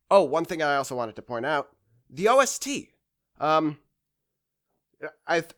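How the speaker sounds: ordinary talk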